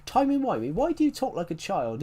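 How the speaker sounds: high-pitched mock disbelief; John Hurt. Doctor speaking to his previous incarnation, mock incredulous. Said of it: "I love John Hurt's line".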